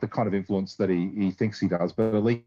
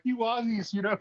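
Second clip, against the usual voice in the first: mock exasperated; 10 semitones higher than usual. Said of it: "he sounds so exacerbated".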